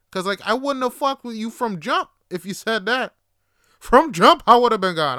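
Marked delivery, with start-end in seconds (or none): 3.8-5.2 much louder, higher outburst; shouted emphasis. Real, not acted. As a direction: blast it out.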